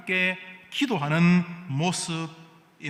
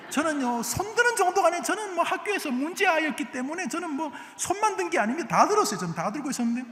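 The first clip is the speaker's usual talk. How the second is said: much higher-pitched voice; high-pitched comic self-mockery; comic confession; 11 semitones higher, faster than usual. Real, not acted. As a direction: confess it in a high, squeaky register. Comic confession of own school punishments.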